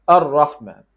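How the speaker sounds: touch once on the R of 'Ar-Rahman'; one-touch R. Shown as a demonstration. Teacher demonstrating the fix, single firm tap. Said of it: "just one touch".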